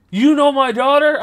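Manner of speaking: very high, loud, startled question; shocked dad. Retold or imitated. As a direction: burst out in disbelief, imitating a stunned father. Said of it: "he snaps his head so fast to me".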